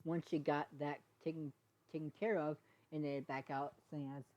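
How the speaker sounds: ordinary delivery, nothing marked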